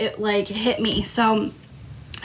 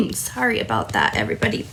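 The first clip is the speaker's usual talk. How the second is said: voice strained by coughing; 4 dB quieter, faster than usual. Real, not acted. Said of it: "bear with me with my voice".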